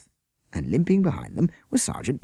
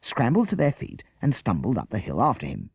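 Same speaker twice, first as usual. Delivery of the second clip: ordinary delivery, nothing marked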